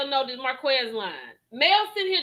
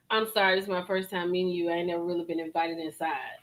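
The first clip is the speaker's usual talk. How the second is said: quick, soft, lower mock of the shy guest; mock timid guest; 9 semitones lower, 6 dB quieter, faster than usual. Reactor mimics the nervous guest's excuse, quick and hushed. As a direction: mimic his sheepish excuse, hushed and fast. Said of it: "Now she's like".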